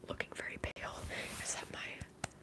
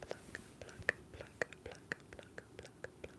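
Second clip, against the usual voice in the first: whispered, rhythmic mouth-sound repetition; repeated trigger whisper; 5 dB quieter, much slower than usual. Shown as a demonstration. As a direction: whisper it over and over as an ASMR trigger. Whispered ASMR trigger word repeated.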